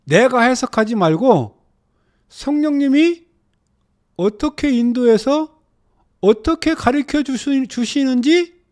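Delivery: loud, high-pitched exhortation; emphatic preaching. Real, not acted. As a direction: preach it forcefully, phrase by phrase. Exhorts the class.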